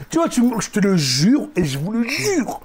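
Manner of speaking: ordinary talk, nothing marked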